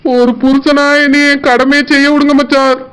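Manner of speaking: ordinary delivery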